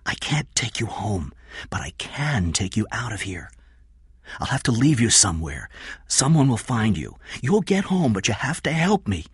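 hushed, urgent instructions; half-whispered, pressing explanation; urgent Kathy. Acted. Kathy explains escape to Jeff, hushed urgency.

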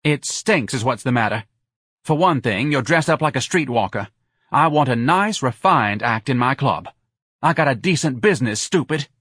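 irritated club owner's rebuke; scornful bar owner. Acted. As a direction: tear into the employee scornfully.

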